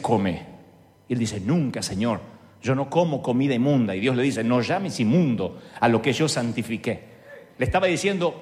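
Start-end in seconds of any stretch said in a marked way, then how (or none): 0.0-7.2 lower, solemn voice of God; deep voice of God. Pretend. God speaks to Peter in a low solemn voice.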